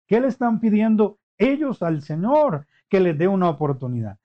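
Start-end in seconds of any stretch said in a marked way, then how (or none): none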